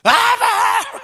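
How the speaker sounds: high, drawn-out mock roar; retold outburst. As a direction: replay the burst of temper, half-laughing. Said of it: "제가 막 버럭 화를 냈어요".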